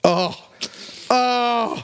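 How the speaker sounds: high, drawn-out tearful moan; hammed-up crying sound; mock weeping. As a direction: wail "oh" as the waterworks start. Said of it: "All the water".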